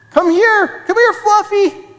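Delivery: high, eager dog call. Acted. Children hearing the lost puppy nearby, calling out.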